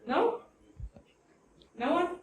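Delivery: ordinary talk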